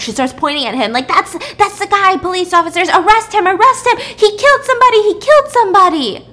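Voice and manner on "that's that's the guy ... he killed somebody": high, loud panicked accusation; freaking out. Retold or imitated. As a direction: shriek it out, pointing at the killer in panic. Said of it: "she starts freaking out".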